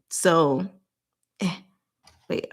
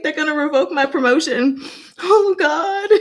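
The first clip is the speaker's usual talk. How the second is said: high, giggly wail; laughing mock panic; 8 semitones higher, 4 dB louder than usual. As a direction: act out past panic through laughter. Laughing at her own past panic.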